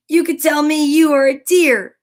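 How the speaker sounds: high, loud teasing deer; deer retorting. A narrator's reading. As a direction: turn his words back on him. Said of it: "said the deer".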